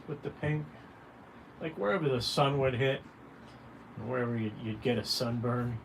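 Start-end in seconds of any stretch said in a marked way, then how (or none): none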